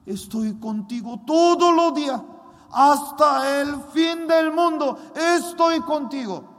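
high, loud preaching proclamation; God's promise proclaimed. Retold or imitated. God promising Daniel his presence, loud fervent proclamation. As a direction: shout the promise with full force. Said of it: "Esta palabra, "Daniel".

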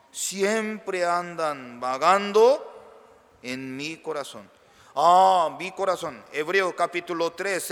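ordinary delivery

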